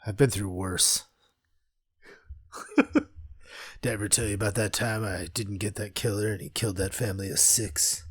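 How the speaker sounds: low, quiet deadpan; jaded tough guy. Acted. Host playing the unbothered hero recalling a killer, low and deadpan. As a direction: toss off the horror in a low voice.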